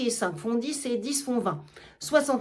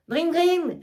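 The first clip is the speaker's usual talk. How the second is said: higher ringing "dring, dring" like a hand bell; bell imitation; 6 semitones higher, 4 dB louder than usual. Argan ringing for his servants, mimicking a bell. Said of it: "Il sonne une sonnette".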